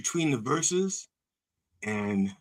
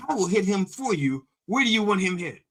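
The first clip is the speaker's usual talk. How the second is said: eager instigator; 7 semitones higher than usual. Retold or imitated. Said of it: "It says".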